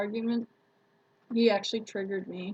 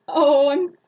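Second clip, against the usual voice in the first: embarrassed apology; 4 semitones higher, 9 dB louder than usual. Retold or imitated. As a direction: back off, caught out by the wrong number. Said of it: "I'm like".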